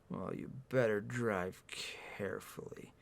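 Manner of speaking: low, hushed, slow warning; quietly authoritative, drawing the words out; soft-spoken stern man. A narrator's reading. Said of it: "His tone is stern, authoritative".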